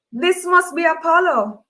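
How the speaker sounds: excited greeting. A narrator's reading. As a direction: greet him with a lift. Host greets guest excitedly.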